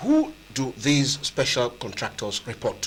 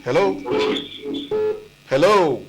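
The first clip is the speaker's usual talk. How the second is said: calling out; 6 semitones higher than usual. Real, not acted. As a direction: call out to check the line. Calling out to a silent caller.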